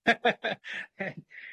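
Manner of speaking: high chuckle; a short, high-pitched laugh of his own. Real, not acted. Laughs after retelling his news.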